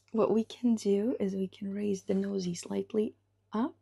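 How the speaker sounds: ordinary talk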